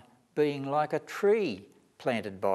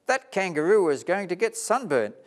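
worried cry; 8 semitones higher, 4 dB louder than usual. Acted. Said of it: "cried Tina".

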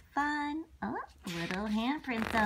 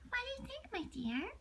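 much softer, gentle asking; tender question; 10 semitones higher, 9 dB quieter, faster than usual. Acted. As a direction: ask the little one softly and lovingly.

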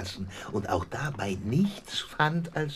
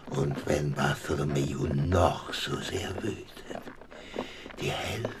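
conspiratorial whisper; 8 semitones lower, 6 dB quieter than usual. Acted. Thaddeus promises Miss Morstan her half, hushed and conspiratorial. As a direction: confide the secret softly.

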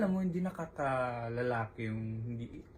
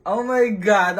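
loud, higher burst of nerves; excited exclamation; 6 semitones higher, 12 dB louder, slower than usual. Real, not acted. Host nervous before the big question, excited outburst. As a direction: blurt it out, keyed up and nervous.

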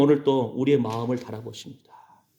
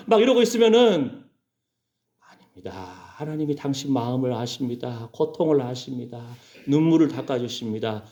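usual speaking voice

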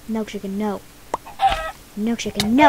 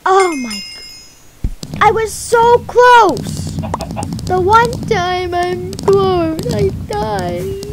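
frustrated wail; 6 semitones higher, 5 dB louder, slower than usual. Real, not acted. Dies when close to winning.

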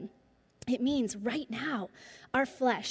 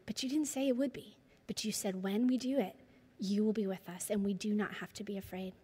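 soft, earnest closing prayer; quiet pastoral prayer; 4 dB quieter than usual. Acted. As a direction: pray over them gently, slowing down. Closing prayer over congregation.

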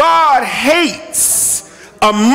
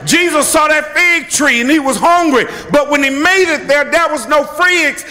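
ordinary talk

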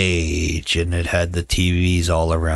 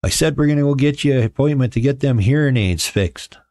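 higher, emphatic mock explanation; teasing, raised-pitch retort; retold comeback; 7 semitones higher than usual. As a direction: repeat it up high for someone hard of hearing. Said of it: "I'm like".

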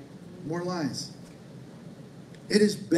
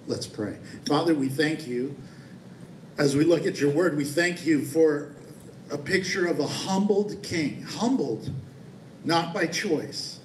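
lower, earnest prayer voice; 3 semitones lower than usual. Acted. Pastor leading congregation in prayer, lower and earnest. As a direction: pray it humbly to the Father.

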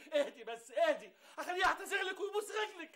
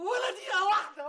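firm loud refusal; 4 semitones higher, 7 dB louder than usual. Acted. Husband refuses, excited outburst.

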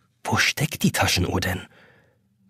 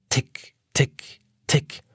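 whispered ticking; 3 semitones higher, 3 dB quieter, much slower than usual. A narrator's reading. Clock ticking before the hour.

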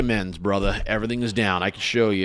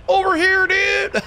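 very high, louder cartoon squeak of over here dude; squeaky mock call; 4 dB louder, slower than usual. Streamer mocking the truck that went past, hammed-up high squeak. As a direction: squeak it out like a silly little cartoon voice. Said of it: "just went up just casually, like".

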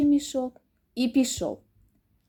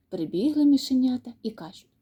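plain reading voice

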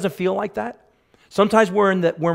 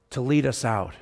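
ordinary talk, nothing marked

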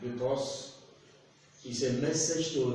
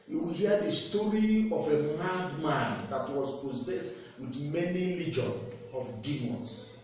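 usual speaking voice

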